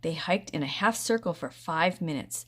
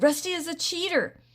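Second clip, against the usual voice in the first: loud, much higher jeering shout; taunting shout; 12 semitones higher, 5 dB louder than usual. A narrator's reading. Beanie shouting taunts at Rusty. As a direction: yell the insult at him. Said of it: "Beanie shouted".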